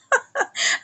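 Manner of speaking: high, brief scoffing laugh; short laugh. Retold or imitated. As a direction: laugh off the threat. Mocking laugh while telling the confrontation.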